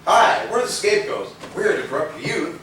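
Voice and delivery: mock introduction. To the band, mocking how they'd walk in: hammed-up greeting. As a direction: put on a sunny mock welcome. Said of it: "waltz into the city and say".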